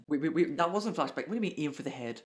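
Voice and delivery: loud, sudden interruption; alarmed confusion. Real, not acted. Reader stopping the story over a misread flashback, alarmed confusion. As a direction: cut in loudly, thrown by it.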